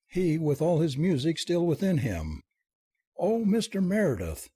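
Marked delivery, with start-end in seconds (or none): 3.2-4.5 put-on high-pitched girlish exclamation; lifted, swooning exclamation; faint cry. A narrator's reading. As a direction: cry out, overcome with admiration. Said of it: "she cried faintly".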